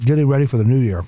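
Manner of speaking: talking while chewing; words round a bite of salad; mouth full. Real, not acted. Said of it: "Sorry, I'm chewing in the mouth".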